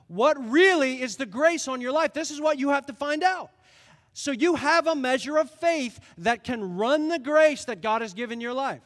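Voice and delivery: loud, high preaching push. Real, not acted. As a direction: drive it home to the congregation. Preaching at full force.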